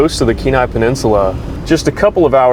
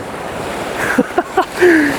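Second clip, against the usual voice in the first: delighted high laugh; 4 dB quieter than usual. Real, not acted. Laughing at the seal.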